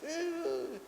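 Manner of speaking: very high, drawn-out mock wail; imitated child whine. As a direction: squeal like an upset toddler. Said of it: "when he's upset with me and he says".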